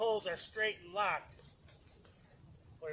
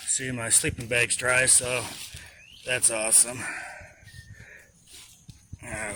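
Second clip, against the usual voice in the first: ordinary talk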